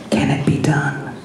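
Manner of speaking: whispered, sinister question; whispered emperor. A narrator's reading. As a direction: hiss it like a scheming emperor.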